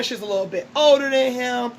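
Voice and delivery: normal talking voice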